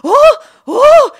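high, loud yelps of shock; startled yelp. Retold or imitated. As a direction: yelp as the cold water hits. Cold water hits her.

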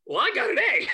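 smug brag. Retold or imitated. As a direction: shrug her off, pleased with himself. Smug classmate answers crying girl.

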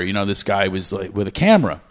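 normal talking voice

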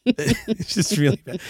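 laughing through the words. Real, not acted. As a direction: chuckle while saying it. Laughing at own state.

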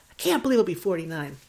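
usual speaking voice

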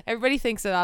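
ordinary delivery

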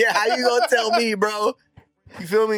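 high and loud, fired up; excited. Real, not acted. Telling the crew about singing Spanish, high and loud.

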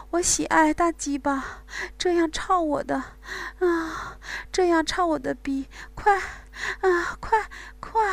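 high whimpering, moaning female voice; whimpering moans. Acted. Bai Wei to Wang Gang, high whimpering moans. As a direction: whimper and moan, rising in intensity. Woman character continues moaning.

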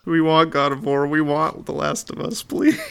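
high, whiny plea for games; whiny begging voice. Pretend. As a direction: beg like a spoiled kid, rising to a squeak. Begging for PlayStation exclusives.

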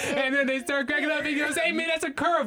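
high pitched, laughing through it; can barely get the words out laughing; laughing while retelling. Real, not acted.

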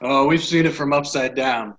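ordinary talk

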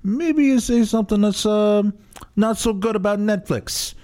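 put-on high, fast mobster-style New York accent; New York wiseguy voice, pitched high; crappy New York accent. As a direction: play a shady New York fixer offering a bribe. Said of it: "a crappy New York accent".

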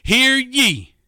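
booming court crier call, high and loud; town crier. Pretend. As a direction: announce the judge like a bailiff. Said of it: "I'm gonna go Flip Wilson on everybody".

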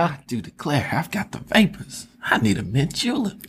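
high breathy southern belle voice. Pretend. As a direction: swoon like a fainting Southern lady. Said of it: "the old South".

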